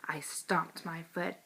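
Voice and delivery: plain reading voice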